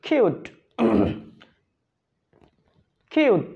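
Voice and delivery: "কিউট" said with a long u; correct pronunciation. Shown as a demonstration. Teacher models "কিউট" after spelling it out. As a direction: say "কিউট" slowly and clearly, long u. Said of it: "উচ্চারণ আমরা এটা সঠিক করি".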